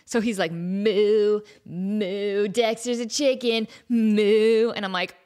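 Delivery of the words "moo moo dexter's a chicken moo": loud goofy moo as Ed. Retold or imitated. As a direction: moo it like a clueless fry cook. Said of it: "Ed doesn't know what a chicken sounds like".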